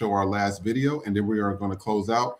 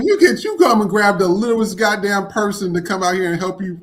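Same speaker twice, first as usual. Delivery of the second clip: mimicking angry girl; 8 semitones higher, 7 dB louder than usual. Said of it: "she's like".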